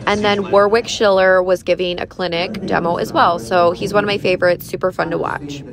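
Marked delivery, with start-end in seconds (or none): none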